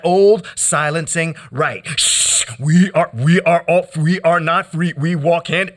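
rhythmic, insistent spoken-word chant; poetry performance. Acted. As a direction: worry each word like a drumbeat. Performed poem continues.

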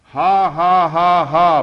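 big, high, spoken-out ha-has; loud performed laughter. A narrator's reading. Narrator acts the men laughing by the wall, loudly. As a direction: belt out each laugh syllable. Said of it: "louder than anybody".

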